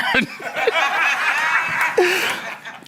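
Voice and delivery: high burst of laughter; amused laughter; real laugh. Real, not acted. Laughing at Richmond's reply.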